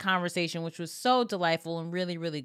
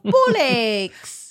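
mock British accent; 2 semitones higher, 7 dB louder than usual. Mocking British accent banter.

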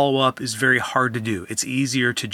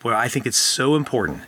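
usual speaking voice

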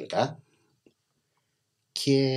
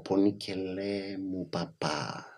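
put-on soft, slow little girl in Cypriot; small child's Cypriot, spoken softly and slowly; cypriot greek accent; 2 semitones lower, 10 dB quieter, much slower than usual. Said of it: "μου σκάει εκείνο το".

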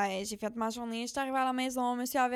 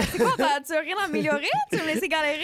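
louder, playfully outraged questions; mock indignation; 4 semitones higher, 6 dB louder than usual. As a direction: call the commenter out in jest. Mock scolding absent commenter. (indignation moqueuse, plus fort)

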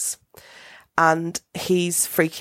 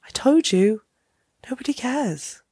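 imitating the sister, higher; 8 semitones higher than usual. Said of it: "she's like".

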